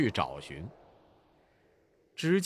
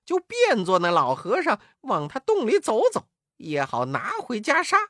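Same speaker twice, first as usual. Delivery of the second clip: animated, raised voice of a scheming Wukong; gleeful Monkey King; 4 semitones higher, 4 dB louder than usual. A narrator's reading. Wukong plots to pose as the old monk, gleeful. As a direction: relish the trick, lively and high.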